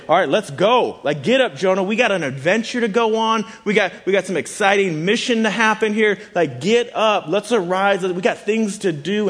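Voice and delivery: rally someone for an adventure with mounting energy; acted-out excited summons; rousing excitement. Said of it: "communicating excitement".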